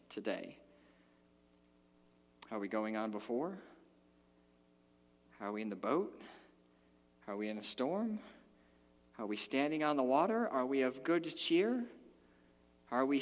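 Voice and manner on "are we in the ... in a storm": normal talking voice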